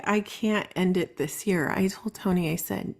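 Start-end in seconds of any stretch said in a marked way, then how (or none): none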